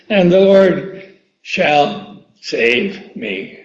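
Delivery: drawn-out scripture line; slow reverent reading. Shown as a demonstration. Preacher reading the promise of the verse, slow and reverent. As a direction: linger on every word.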